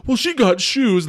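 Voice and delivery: high, loud, slow indignant retort, like a sulky husband; mock whining protest. Husband defending the suit to his wife, mock indignant and high. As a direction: stretch it out in a put-upon huff. Cage arguing over suit price.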